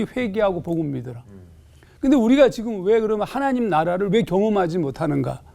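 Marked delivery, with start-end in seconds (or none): none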